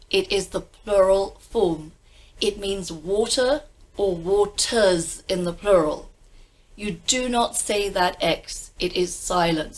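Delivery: usual speaking voice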